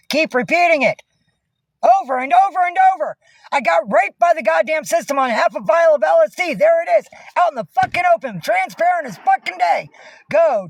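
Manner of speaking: high-pitched, real angry tirade; furious rant. Real, not acted. Streamer raging to viewers about the system, furious rant. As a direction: rant at full tilt, pitch pushed way up. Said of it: "I'm so fucking pissed".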